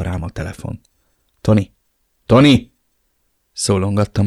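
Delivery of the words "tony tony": calling out. Acted. Calling lost son.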